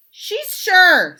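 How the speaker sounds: put-on high, loud kids; kids' voice. Kids answering the principal for their teacher, high and loud. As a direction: chirp it out like the class.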